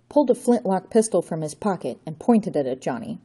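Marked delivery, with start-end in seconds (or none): none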